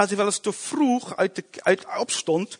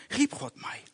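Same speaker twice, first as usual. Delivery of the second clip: ordinary delivery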